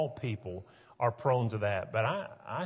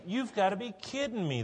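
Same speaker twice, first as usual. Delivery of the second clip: higher, disbelieving scolding of the officers; incredulous Pharisees; 7 semitones higher than usual. Retold or imitated. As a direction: play the outraged Pharisee who can't believe it.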